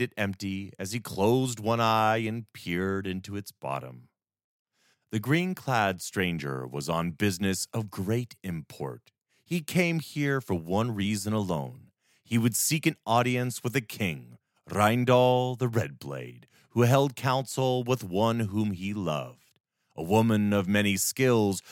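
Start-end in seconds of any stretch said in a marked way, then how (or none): none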